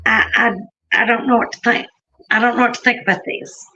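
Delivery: ordinary delivery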